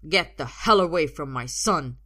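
loud, protective father's order; forceful angry shout; angry command. A narrator's reading. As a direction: bellow a warning at the attacker. Father confronts his son's attacker.

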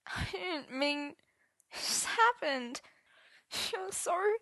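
high, soft, faltering whimper; tearful stammer. A narrator's reading. Arthur excusing himself to Merlin, soft tearful stammer. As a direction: break off as if near tears.

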